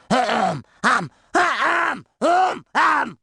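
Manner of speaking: repeated showy warm-up hems; exaggerated throat clearing. SpongeBob preparing his pirate example, showy throat clearing.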